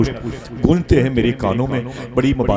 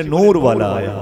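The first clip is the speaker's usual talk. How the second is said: loud call; 4 dB louder than usual. Shown as a demonstration. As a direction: belt it out so everyone joins in. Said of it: "बोले, बोले".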